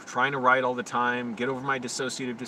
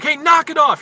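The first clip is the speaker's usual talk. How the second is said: high, very loud angry yell; sudden high-pitched holler; retold scream; 14 semitones higher, 12 dB louder than usual. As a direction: scream it out at someone outside the door. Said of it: "and I screamed".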